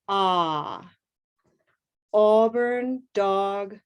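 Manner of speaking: each color word stretched slow and clear, then the bare vowel sound; teacher drilling color vowels for students to echo; slow vowel modeling. Shown as a demonstration. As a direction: model every key word slowly for the class to repeat. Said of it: "repeat after me".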